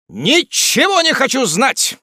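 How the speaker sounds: high, loud, drawn-out refusal; brusque interruption. A narrator's reading. Jingle cuts the lawyer off, defiant interruption. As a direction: cut in and refuse to listen. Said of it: "перебил мистер Джингль".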